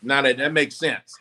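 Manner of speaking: normal talking voice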